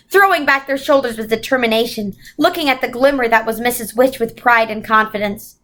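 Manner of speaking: ordinary delivery, nothing marked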